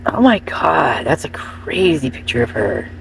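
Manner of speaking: awed hushed whisper, slow. Real, not acted. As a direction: sussurra stupita, piano.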